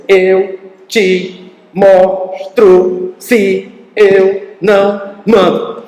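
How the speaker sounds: very slow, each syllable hammered out; mother scolding, one syllable per smack. Retold or imitated. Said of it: "Cada chinelada era uma separação da sílaba".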